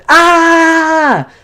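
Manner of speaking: high, loud cathartic scream; full-lung yell. Shown as a demonstration. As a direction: let out a wordless yell at the top of your lungs. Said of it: "yell at the top of your lungs".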